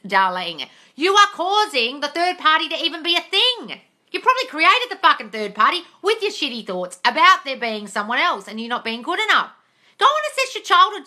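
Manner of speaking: high, loud, exasperated scolding; heated rant. Real, not acted. Host scolding a jealous viewer, high and loud with exasperation. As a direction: let her have it, fed up.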